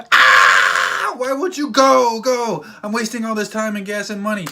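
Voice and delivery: high, loud frustrated shout that eases off by the end; retelling a road-trip rant in a loud, exasperated voice; exasperated yell. Retold or imitated. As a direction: let the impatience burst out, then grumble. Retold frustration at friend's driving.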